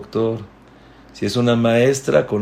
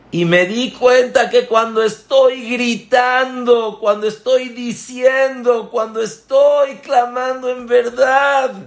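much higher and louder, slow crying out; the rabbi wails his confession; impassioned outcry; 13 semitones higher, 5 dB louder than usual. Acted. Rabino confiesa su grito. (clamor apasionado, mucho más agudo y fuerte, lento)

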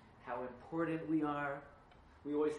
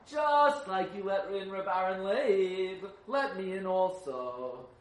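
loud, slow demand to be let in; loud slow plea; 5 semitones higher, 10 dB louder, much slower than usual. Acted. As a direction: plead at the door, slowly and loud. Acted request at the door.

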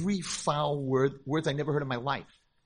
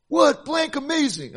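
high, loud incredulous; outraged disbelief; 9 dB louder, slower than usual. Retold or imitated. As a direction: throw it back in shocked disbelief. Girl outraged by compliment.

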